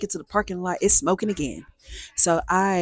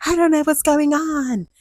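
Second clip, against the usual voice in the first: panicked; 8 semitones higher, 4 dB louder than usual. Retold or imitated. Her own panicked reply retold.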